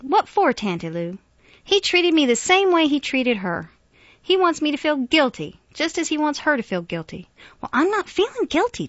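high, loud, heated arguing; angry protest. A narrator's reading. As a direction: argue back in mounting frustration. Grant argues angrily with aunt.

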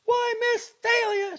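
very high, loud mocking echo; mocking mimicry. A narrator's reading. Angry girl mimicking the doctor's protest, mocking. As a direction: parrot it back loud, slow and high. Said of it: "she mimicked".

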